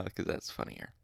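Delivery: plain reading voice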